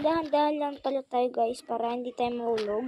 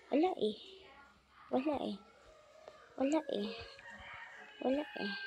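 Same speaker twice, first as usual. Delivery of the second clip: mock sad; 11 dB quieter, much faster than usual.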